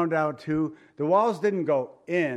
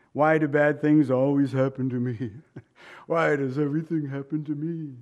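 mock self-pitying complaint. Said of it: "We get like that".

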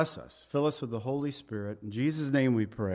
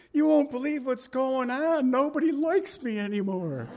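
high, excited woman's voice for Sue; voicing Sue; 11 semitones higher, 3 dB louder than usual. Pastor retelling Sue's phone call, high excited voice. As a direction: play Sue calling back, thrilled and a bit amazed.

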